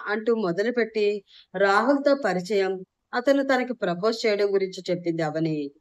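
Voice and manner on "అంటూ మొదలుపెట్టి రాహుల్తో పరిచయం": ordinary delivery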